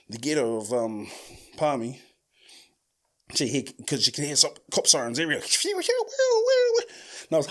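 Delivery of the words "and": very high, very slow stretched whine. Retold or imitated. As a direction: drag it out long and high. Stoned paranoid drawl.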